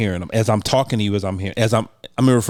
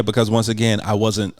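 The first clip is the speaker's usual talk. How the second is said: normal talking voice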